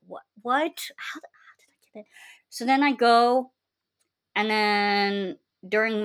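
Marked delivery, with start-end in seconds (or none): 0.1-2.0 fast, breathless whisper of disbelief. Retold or imitated. Retelling her shock at getting in, fast whisper. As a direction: hush it, stunned.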